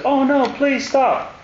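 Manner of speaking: high, slow, hammed-up begging; a high, drawn-out mock plea; mock pleading Pharaoh. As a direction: drag out the plea like a whining king. Pharaoh begging Moses, retold.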